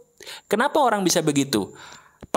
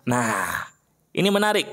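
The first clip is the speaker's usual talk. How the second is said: ordinary delivery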